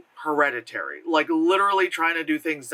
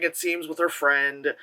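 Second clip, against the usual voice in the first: ordinary delivery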